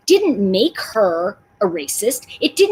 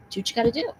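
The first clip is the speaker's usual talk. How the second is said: calm reply; 8 dB quieter, much faster than usual. Retold or imitated. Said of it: "And he goes".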